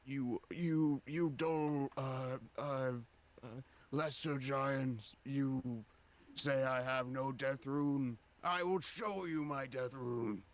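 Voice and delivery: high, loud, slow proclamation; menacing boast. Acted. Death giant to lesser giants, slow menacing boast. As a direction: declaim it haltingly at lesser foes.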